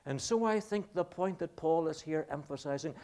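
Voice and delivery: normal talking voice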